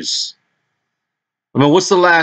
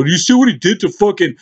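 higher mock fan voice; mocking boxing fan; 8 semitones higher than usual. Mocking a fan bragging about Joshua, higher put-on voice. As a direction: parrot an eager Joshua fan. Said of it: "don't start talking about Anthony Joshua".

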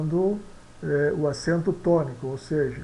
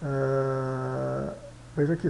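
ordinary talk

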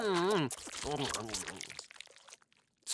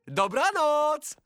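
drawn-out goodnight; 3 semitones higher, slower than usual. Acted. Goes to bed at quarter past seven.

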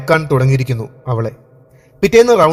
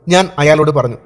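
plain reading voice

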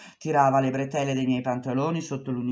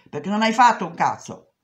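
angry boss; 6 semitones higher, 5 dB louder than usual. Retold or imitated. Boss refuses, shouting.

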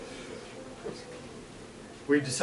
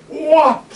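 higher, louder whoop; whoop of the catch; 11 semitones higher, 7 dB louder than usual. Retold or imitated. Storyteller voicing the net swooping, loud whoop. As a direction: swoosh down the net.

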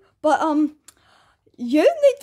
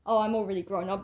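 usual speaking voice

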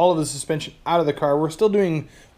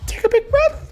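mock gasp. Joking gasp at the exhaust.

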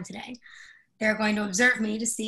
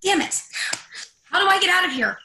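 high, loud, panicked fury; trapped and desperate; frantic anger; 9 semitones higher, 5 dB louder than usual. Acted. Trapped, cursing.

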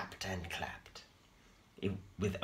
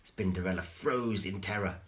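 usual speaking voice

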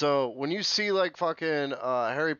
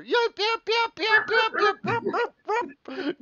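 tiny yapping dog, much higher, yep over and over; imitating yapping corgi. Imagining the Queen's corgis yapping from her casket, high yap. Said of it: "you hear".

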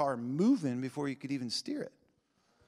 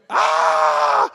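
short hammed-up yell of fright; mock scream; 12 dB louder than usual. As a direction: shout out like someone set alight. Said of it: "they would be going".

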